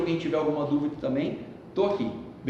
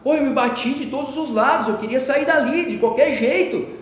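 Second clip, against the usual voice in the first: high, loud and worked up; loud exasperation; 9 semitones higher, 7 dB louder than usual. Retold or imitated. Boy tells the sage how he thrashed about, loud and agitated. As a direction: blurt it out, still shaken. Boy recounts struggling underwater.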